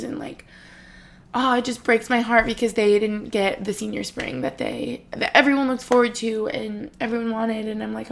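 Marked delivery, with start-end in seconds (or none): none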